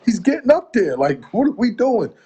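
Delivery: acting out his disbelief at the label; exasperated. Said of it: "I'm like".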